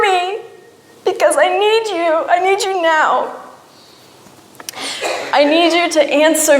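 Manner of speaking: desperate plea climbing from measured questions to high-pitched begging; pleading with God. Retold or imitated. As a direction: start controlled, build to a high, urgent plea. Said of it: "asking God".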